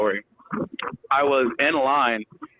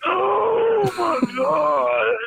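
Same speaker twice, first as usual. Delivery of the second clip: high, very slow held shout; drawn-out shout; much slower than usual. Retold or imitated. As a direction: stretch it out in relief. Relief after farting.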